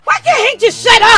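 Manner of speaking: high, loud outburst; exasperated shout. Real, not acted. Fed up with Hank interrupting.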